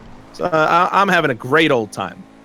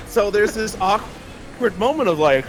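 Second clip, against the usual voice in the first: normal talking voice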